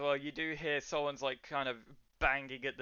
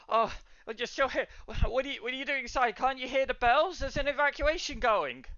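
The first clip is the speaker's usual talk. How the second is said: high, urgent, pitched-up character voice; alarmed barwoman; 10 semitones higher, 6 dB louder, faster than usual. Acted. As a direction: panicky townswoman warning of danger.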